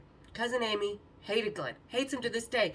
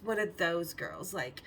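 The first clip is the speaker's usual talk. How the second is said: normal talking voice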